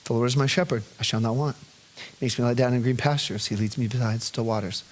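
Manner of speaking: low, soft, tender recitation; soft recited psalm. Retold or imitated. Father reciting psalm to calm daughter, soft and tender. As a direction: murmur the psalm gently. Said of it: "speak Psalm twenty-three over her".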